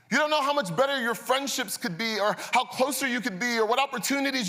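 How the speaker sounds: high, loud, rising sermon climax; full-force preaching. Acted. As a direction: preach it out, building intensity. Preaching the counselor's words.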